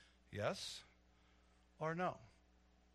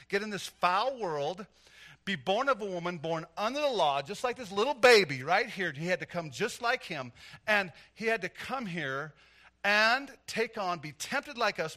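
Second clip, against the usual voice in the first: loud, higher, stretched sermon delivery; full-force preaching; 6 semitones higher, 12 dB louder than usual. Acted. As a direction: drive each phrase home to the congregation.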